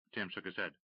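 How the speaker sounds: plain reading voice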